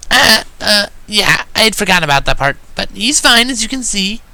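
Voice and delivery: sheepish high pony voice, deflecting; flustered. A narrator's reading. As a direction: bluster past the awkward truth. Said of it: "she distracted".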